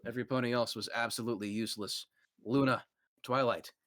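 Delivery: ordinary talk, nothing marked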